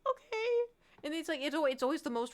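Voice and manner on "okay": very high squeaky okay; flustered. Retold or imitated. Streamer at a random fan encounter, flustered.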